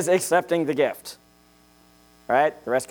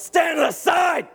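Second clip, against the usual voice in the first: high, drawn-out command yell; full-force military order; drill instructor shout; 14 semitones higher, slower than usual. Acted. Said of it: "They would tell us".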